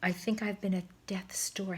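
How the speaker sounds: soft whisper; whispered thought. A narrator's reading. Old swan thinks on stretcher, soft whisper. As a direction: breathe the thought weakly. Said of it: "he thought".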